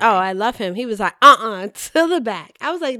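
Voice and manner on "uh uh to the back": loud, slow mock command; imitated stern order. Fan re-enacting Stanley on pretzel day, imitated stern order. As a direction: draw out an imitated order to move back.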